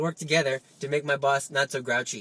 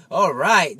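loud, eager devil's approval; lightly voiced devil, punched loud; guardian devil; 9 dB louder than usual. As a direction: exclaim it like a delighted tempter. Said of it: "Your guardian devil says".

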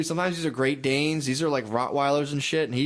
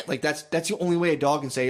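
ordinary delivery

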